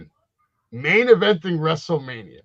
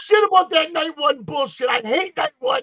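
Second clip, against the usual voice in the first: high, loud heated rant; high indignant rant; 10 semitones higher, 6 dB louder than usual. Real, not acted. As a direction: rant like a die-hard fan. Heated wrestling argument.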